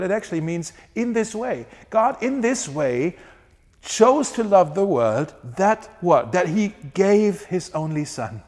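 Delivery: ordinary talk, nothing marked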